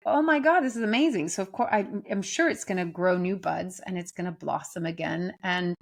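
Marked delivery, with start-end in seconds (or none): 0.1-1.3 delighted gasp. Retold or imitated. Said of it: "it's like".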